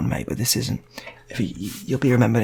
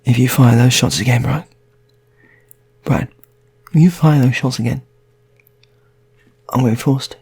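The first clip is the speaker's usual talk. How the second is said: louder, higher angry yell; furious shout; 4 semitones higher, 5 dB louder than usual. Acted. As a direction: bark the threat at Brian. Unarmed hostage threatens gunman Brian.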